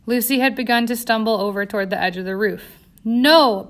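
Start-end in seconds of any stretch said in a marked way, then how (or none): 3.0-3.7 louder sharp warning scream. A narrator's reading. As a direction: scream to stop her going over the edge. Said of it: "Bella screamed at her".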